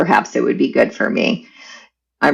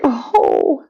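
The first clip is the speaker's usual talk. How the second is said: high, pained mock yelps, much higher; realising the mother knew, high pained cries; 10 semitones higher, much slower than usual. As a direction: yelp as if stung by the truth. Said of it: "And I was like".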